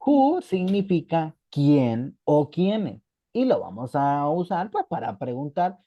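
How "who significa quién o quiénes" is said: slow teaching. Shown as a demonstration.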